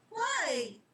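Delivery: high, raw demanding question; boy's growing rage at the reaper; angry demand. Acted. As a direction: fling the question at him. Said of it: "Charlie demanded".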